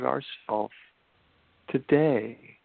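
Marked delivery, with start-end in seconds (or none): 1.7-2.6 today said with a firm falling stress. Shown as a demonstration. Speaker stressing today to listeners, firm falling stress. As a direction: lean on the word today to make the point. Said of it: "Can you notice I'm emphasizing the word today?".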